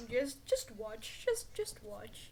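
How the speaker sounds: high, slow singsong warning; mock ominous tease. Teasing the editor about a sneeze, slow high singsong.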